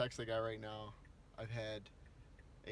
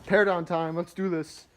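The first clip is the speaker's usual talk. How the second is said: pumped up; 4 semitones higher, 12 dB louder than usual. Real, not acted. Pumped to start work.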